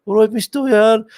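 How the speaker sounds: high, loud put-on soap voice; a light Midlands soap imitation; Crossroads character voice.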